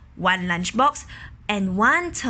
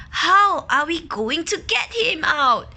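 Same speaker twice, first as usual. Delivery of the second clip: worried lady; 7 semitones higher, 3 dB louder than usual. Acted. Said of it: "the middle-aged lady asked".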